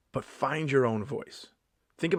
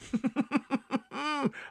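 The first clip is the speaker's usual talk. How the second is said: high-pitched laughter; high giggle; 3 dB quieter than usual. Real, not acted. Comedian after telling off the audience, high giggle.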